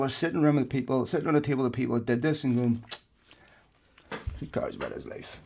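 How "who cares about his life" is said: very soft, voiceless mock whisper; hushed callous aside. Football executives at the table dismissing a death, hushed whisper. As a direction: whisper it conspiratorially, dismissing the man.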